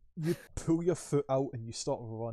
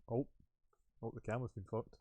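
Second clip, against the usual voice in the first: robotic glitching voice; 5 dB quieter, faster than usual. Real, not acted. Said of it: "you went all robotic".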